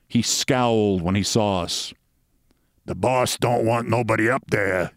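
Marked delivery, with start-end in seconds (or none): 2.8-4.9 lightly voiced foreign thug; foreign henchman. A narrator's reading. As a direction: turn them away as the boss's driver. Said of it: "a heavy-set foreigner with cruel lips".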